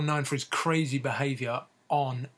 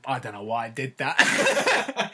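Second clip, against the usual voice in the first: slow, lightly voiced mock Artest; half-laughing take on a baffled athlete; a hint of sheepish shrug in the voice; mumbled Artest impression; 3 dB louder, slower than usual. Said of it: "him saying".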